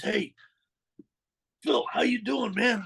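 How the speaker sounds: lightly voiced cheerful brother calling out; a hint of a cheery younger brother; imitating brother's greeting. Brother greeting him in jail.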